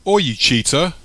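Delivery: loud, high indignant shout. A narrator's reading. Mike chases Sam in a race, shouting after her. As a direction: yell after someone running off. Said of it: "shouting after her".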